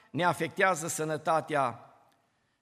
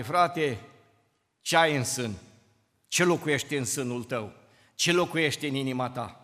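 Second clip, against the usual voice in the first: gentle question; 6 semitones lower than usual. Retold or imitated. Preacher asking a brother gently, low and searching. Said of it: "Să-i spun unui frate".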